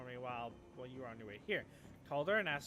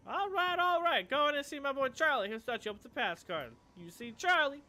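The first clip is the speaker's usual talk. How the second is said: much higher, louder forced friendliness; smooth it over with a bright fake grin; cheerful hustler; 9 semitones higher, 8 dB louder than usual. Clocker waves the runners in, high and forced-friendly.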